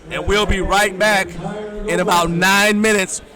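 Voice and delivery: loud, slow broadcast sign-off; announcer voice. Acted. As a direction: announce it to the whole hall.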